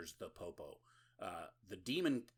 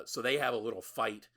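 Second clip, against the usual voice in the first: ordinary delivery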